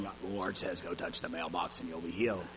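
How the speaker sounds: a hushed, exaggerated pretend-spiritual pronouncement; mock prophet. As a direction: ham up a trembling mystic prophecy. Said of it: "eyes roll back in our head and we're shaking going".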